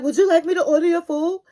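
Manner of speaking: quick, put-on voice mimicking him; mock him with a quick silly voice; mocking imitation of Jamal. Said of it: "And then you're like".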